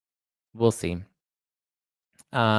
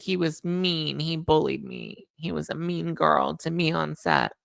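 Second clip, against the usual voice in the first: higher, slower whiny tattletale voice; mock whiny complaint; 6 semitones higher, slower than usual. Host mocking an actress's complaint, lightly whiny. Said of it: "it sounds like she's like".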